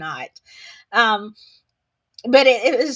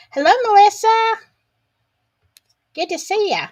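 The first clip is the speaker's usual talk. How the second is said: cheery greeting; 10 semitones higher, 7 dB louder than usual. Real, not acted. Greeting chat viewer.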